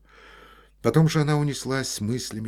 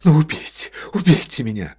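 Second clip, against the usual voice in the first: loud hysterical cry, much higher; shouting; 8 semitones higher, 10 dB louder than usual. A narrator's reading. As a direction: scream it at him. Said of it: "крикнула Ольга Ивановна".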